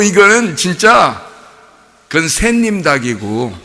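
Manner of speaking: ordinary talk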